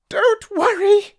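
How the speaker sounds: very high squeaky little mouse voice; a tiny mouse piping up to reassure. Acted. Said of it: "said Mouse".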